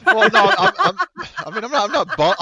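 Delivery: loud, high, laughing through the words; laughing protest. Real, not acted. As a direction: stammer a defence through real laughter. Laughing while defending his remark.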